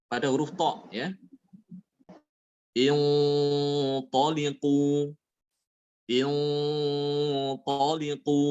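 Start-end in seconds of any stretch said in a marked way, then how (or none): 2.8-8.4 slow, heavy nasal hum opening "Intaliqu"; thick ghunnah. Shown as a demonstration. Teacher shows the heavy letter ta, thick held nasal. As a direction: hold the first syllable of "Intaliqu" thickly. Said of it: "gunanya ikut tebal".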